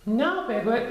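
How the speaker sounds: put-on high boyish Christopher Robin voice; young Christopher Robin explaining. A narrator's reading.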